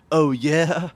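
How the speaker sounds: one-upping. Acted. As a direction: act out a boastful comeback. Acting out a one-upping parent.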